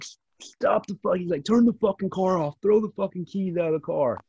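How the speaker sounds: urgent high-pitched imitation of a yell; retold yelling. Cop shouting orders at a pulled-over driver, retold yell. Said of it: "he's yelling, he's cussing me".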